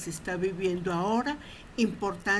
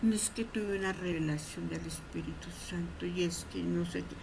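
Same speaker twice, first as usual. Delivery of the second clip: mock mystic testimony; 5 dB quieter than usual. Shown as a demonstration. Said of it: "No comparta experiencia mística".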